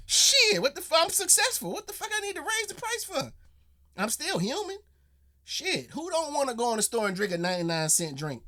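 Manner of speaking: mock Don voice. Reactor imitates tea CEO refusing price hike, high mock voice. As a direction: ham up a carefree rich boss.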